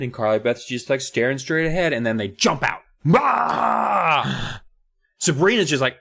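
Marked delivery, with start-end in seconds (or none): 3.1-4.6 high startled "Ah!"; mock scare shout. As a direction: yelp it like jumping out of a hedge. Bullies jump out scare.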